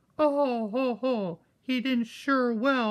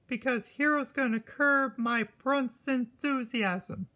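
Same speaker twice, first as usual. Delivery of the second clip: ordinary delivery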